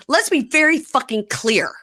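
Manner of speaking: high, loud mock outburst; heated retold rant; very mad. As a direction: act out an angry husband standing his ground. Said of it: "Stands up very mad and says".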